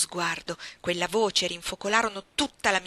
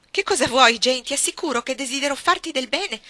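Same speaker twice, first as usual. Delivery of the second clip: voce più alta e forte, tono ansioso da zia; signora Reed turbata; 6 semitones higher, 9 dB louder than usual. A narrator's reading. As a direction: cerca di calmare la bambina, allarmata. (zia spaventata che tenta di calmare Jane, agitazione)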